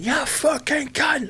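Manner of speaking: slow, pitched high, strangled yell; mock road-rage yell. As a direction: yell it through the windscreen. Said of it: "window down".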